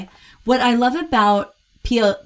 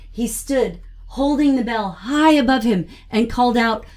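"He stood" set apart, "high above him" raised and drawn into "called out"; reading to the commas; 3 semitones higher, 3 dB louder than usual. Shown as a demonstration. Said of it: "If you'll notice how I said".

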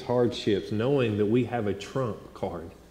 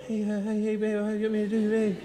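high, fast, helpless pleading; frantic at his wife's side in labour; panicked husband; 11 semitones higher, faster than usual. Retold or imitated. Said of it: "like, going".

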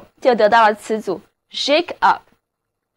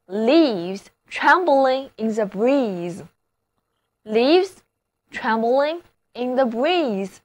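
slow model reading; much slower than usual. Shown as a demonstration. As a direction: read slowly and clearly for learners. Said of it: "比方说". (慢速示范朗读)

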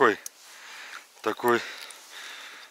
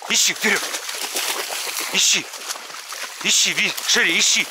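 talking to a dog; 5 semitones higher, much faster than usual. Real, not acted. Sending dogs to search.

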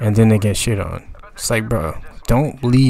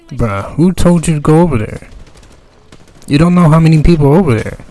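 exasperated yell; 6 semitones higher, 8 dB louder than usual. Real, not acted. Yelling at a careless teammate.

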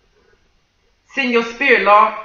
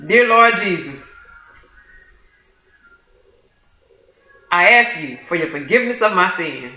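prayer of salvation. Real, not acted.

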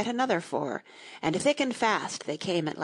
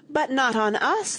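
higher, mildly alarmed protest; alarmed cry; 6 semitones higher, 4 dB louder than usual. A narrator's reading. Oysters fearing the walrus will eat them, mildly alarmed cry. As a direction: cry out against it with a touch of alarm. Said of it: "the oysters cried".

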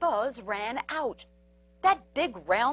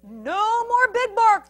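loud, drawn-out crowd cheer; slow, gleeful storybook cheer; 8 semitones higher, 7 dB louder, slower than usual. A narrator's reading. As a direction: cheer it high like a gleeful mob. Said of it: "the crowd cheered".

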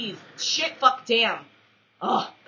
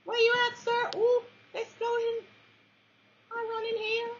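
high squeaky voice. Pretend.

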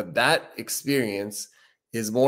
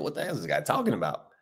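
scoffing skeptic; 7 semitones lower, 7 dB quieter, faster than usual. Retold or imitated. Imagined skeptical viewer scoffing.

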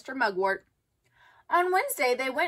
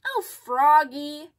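slow, drawn-out exasperated groan; 3 semitones higher, 5 dB louder, much slower than usual. A narrator's reading. Dad groaning at Froggy in the car, slow and weary. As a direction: sigh it out, fed up. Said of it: "groaned Dad".

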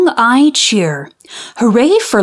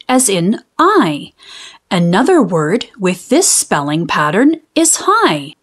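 ordinary delivery, nothing marked

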